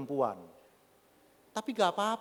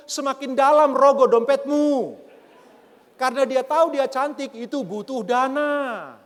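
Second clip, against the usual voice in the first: loud, high exhortation with drawn-out endings; full-force preaching; 7 semitones higher, 6 dB louder than usual. Acted. Preacher telling husbands to work hard, full-force preaching. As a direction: drive it home to the men, loudly.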